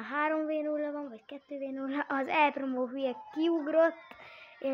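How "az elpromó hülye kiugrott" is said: ordinary talk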